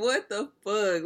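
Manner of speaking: high, loud disbelieving cry; stunned disbelief. Real, not acted. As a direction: squeal in outrage.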